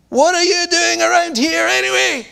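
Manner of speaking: high, sharp big-brother demand; scornful brother's challenge. Acted. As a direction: confront him like an angry older brother. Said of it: "he demanded".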